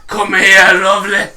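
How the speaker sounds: lightly mock the Welsh lilt; a badly faked Welsh woman calling; bad Welsh accent. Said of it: "the worst Welsh accent I've ever heard".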